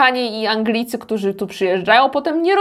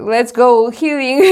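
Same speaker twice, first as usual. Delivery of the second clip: slow Konglish "let's go healing"; drawn-out Korean-style "let's go healing"; Konglish English; 5 dB louder, much slower than usual. Retold or imitated. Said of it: "tym swoim angielskim mówią do ciebie".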